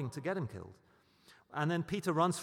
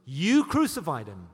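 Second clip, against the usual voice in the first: loud, direct charge at the crowd; confrontational accusation; 9 dB louder than usual. Acted. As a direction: eyeball them and hurl the accusation. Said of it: "He eyeballs them".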